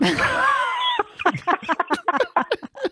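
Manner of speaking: a big shrieking burst of laughter. Real, not acted. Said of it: "big laugh".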